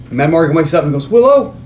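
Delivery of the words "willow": calling out. Retold or imitated. Waking and calling out.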